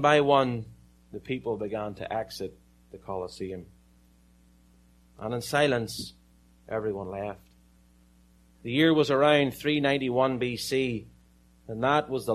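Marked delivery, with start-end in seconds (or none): none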